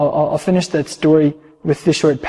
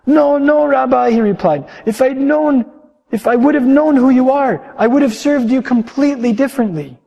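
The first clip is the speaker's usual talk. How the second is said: much higher, louder insisting; distraught innkeeper; 9 semitones higher, 5 dB louder than usual. Acted. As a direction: protest with regret.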